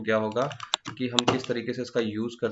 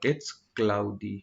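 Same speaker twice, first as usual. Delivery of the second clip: slow example sentence; much slower than usual. Shown as a demonstration. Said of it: "तो आप बोल सकते हो".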